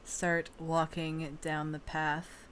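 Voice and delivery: slow, soft, hushed scene setting; eerie narration. Acted. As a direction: draw players into the dead silence. Game master describes eerie path.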